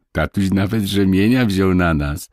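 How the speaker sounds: ordinary delivery, nothing marked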